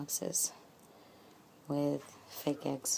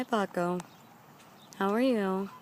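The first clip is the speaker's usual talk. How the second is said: normal talking voice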